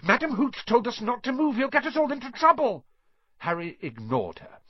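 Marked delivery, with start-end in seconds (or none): 0.0-2.8 high, urgent, speeding up; high-pitched frantic scolding; shouted warning. A narrator's reading. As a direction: warn a friend in a panic. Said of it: "shouted Hermione Granger".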